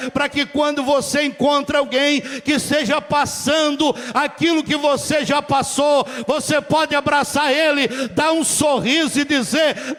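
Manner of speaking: loud, ringing sermon delivery; full-force preaching. Acted. Pastor exhorting the congregation, loud preaching. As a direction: preach it out to the whole church.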